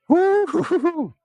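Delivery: sudden very high whoop of a real menthol rush; excited whoop. Real, not acted. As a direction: let out a high, thrilled woo as the burn hits. Menthol stick makes eyes water.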